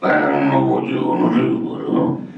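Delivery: forced bass voice; deep chamber voice. Shown as a demonstration. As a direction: drop it way down into a chest chamber. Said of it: "worked enough to create a chamber".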